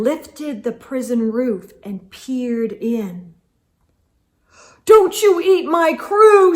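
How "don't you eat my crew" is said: high loud defiant cry; captain shouts at the giant. Acted. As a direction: shout it, fists raised. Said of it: "shouted the captain".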